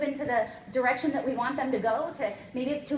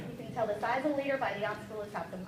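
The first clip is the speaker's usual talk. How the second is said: lower, softer, letting it sink in; softer repeat; 6 semitones lower, 6 dB quieter than usual. Shown as a demonstration. Coach repeating the quote for the team, softer and lower. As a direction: say it again quietly so it lands. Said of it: "Here's one of my favorite quotes".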